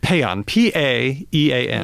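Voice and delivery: paean said slowly, then spelled letter by letter; spelling it out. Shown as a demonstration. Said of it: "there's a word in English".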